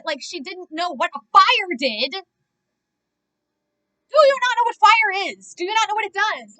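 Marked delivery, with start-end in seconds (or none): 2.1-6.6 higher and much louder, incredulous; exasperated shout. Real, not acted. Yelling at the woman who doesn't know fire, incredulous. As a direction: demand an answer in disbelief.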